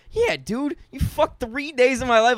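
high, loud mock rant; retelling a furious rant at being stuck inside; angry outburst. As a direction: vent the pent-up anger. Retold angry outburst after quarantine.

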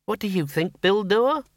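sharp. A narrator's reading. Miss Flitworth pressing Bill about her lost fiancé, sharp. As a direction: pin him down with the question. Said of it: "she said sharply".

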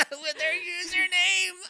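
high, loud laugh breaking through the words. Real, not acted. Host cracking up reading the OnlyFans link, laughing hard. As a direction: squeal it out mid-laugh.

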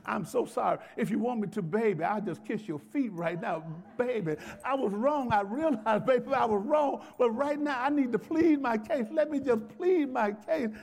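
mock pleading. Husband who messed up begs his wife, mock pleading. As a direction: beg like a sorry husband, hammed up. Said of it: "there's a way to really plead".

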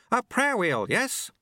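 very high, chirpy character voice; eager apprentice. A narrator's reading. Eager apprentice in time loop.

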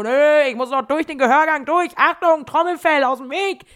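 loud, high, manic refusal; hammed-up defiance. Addicted child refusing mother's order, mock defiant shouting. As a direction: charge ahead shouting, playing it over the top.

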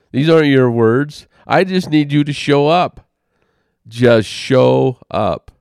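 loud, rising rebuke in a chiding voice; stern divine chastisement. Retold or imitated. The Lord's voice rebuking the father for wanting to cancel, loud and stern. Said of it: "a little bit of a correction, chastisement".

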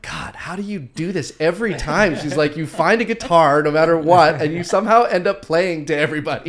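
playfully fed up, laughing at the end; exasperated teasing. Retold or imitated. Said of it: "Joanna's just like".

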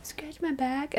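soft, high mimicked request; imitating husband. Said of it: "you're like".

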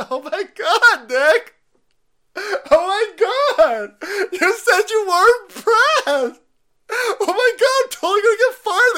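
very high, loud, shrieking mock sobs; mock panicked woman. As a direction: ham up a hysterical woman about to be fired. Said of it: "She's like".

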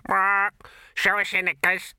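loud, high parrot screech; squawking parrot. A narrator's reading.

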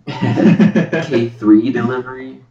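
slow laughing question. Real, not acted. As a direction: laugh it out slowly. Mocking a misheard name.

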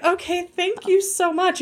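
put-on high, slow little-girl politeness; naive gratitude. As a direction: imitate your naive teenage self thanking someone. Retells naive thanks to driver.

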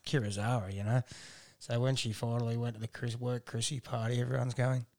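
usual speaking voice

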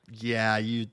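sultry voice. Retold or imitated. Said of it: "she's like".

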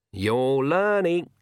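put-on slow, high, wry old witch; drawled-out approval from the old witch; a wry old witch approving Oates; Granny's voice. A narrator's reading.

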